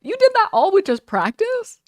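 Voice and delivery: very high, loud astonished question; amazed disbelief. Retold or imitated. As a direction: squeal it in mock incredulity. Acting amazed reaction to friends.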